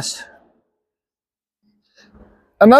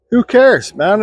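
ordinary delivery

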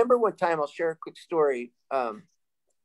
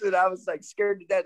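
ordinary delivery

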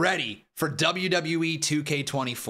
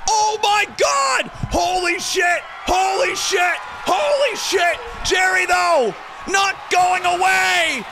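shouted, hyped wrestling commentary; live play-by-play; 8 dB louder, much slower than usual. Acted. Streamer calls the title match finish for chat, shouting and hyped. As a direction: scream the big moments like a ringside caller.

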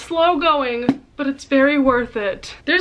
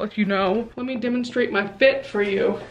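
ordinary talk, nothing marked

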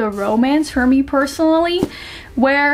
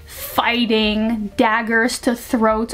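ordinary talk, nothing marked

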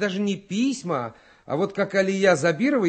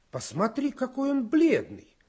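fussing mother; 7 semitones higher than usual. Acted. Mother voiced in story.

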